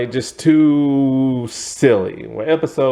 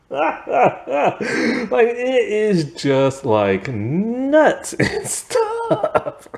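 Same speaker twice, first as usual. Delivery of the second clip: high, slow, sung-out giggly words; laughing singsong; 10 semitones higher, much slower than usual. Real, not acted. Reviewer laughing about a wacky show, sing-song giggle. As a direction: laugh the words out in a lilting tune.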